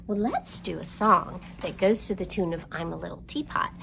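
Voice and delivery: ordinary talk, nothing marked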